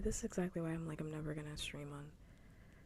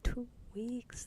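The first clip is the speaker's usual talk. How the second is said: higher, rising hushed disbelief; incredulous; 6 semitones higher, 4 dB louder than usual. Real, not acted. Streamer reacting to a two-week warning, incredulous. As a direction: question it as if you can't believe it.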